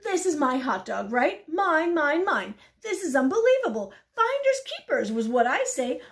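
loud, high indignant rant; pigeon freaking out. Acted. Pigeon claiming its hot dog, indignant rant. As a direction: rant loudly, staking your claim. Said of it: "the pigeon is freaking out".

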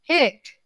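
sudden high-pitched acted hiccup; cartoon tiger hiccup. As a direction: pop out a quick high hiccup. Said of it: "carried on having the hiccup".

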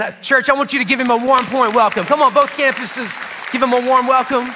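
ordinary delivery

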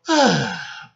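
big, loud falling sigh; long exaggerated sigh of boredom. A narrator's reading. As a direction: heave a fed-up sigh. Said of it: "Ben sighed loudly".

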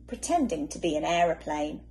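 usual speaking voice

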